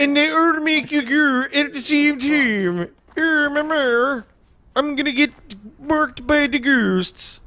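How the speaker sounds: high, slow lightly Canadian narrator; a mild hammed-up Canadian voice; put-on Canadian accent. Voicing a game character in a mild Canadian accent. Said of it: "I love doing this voice".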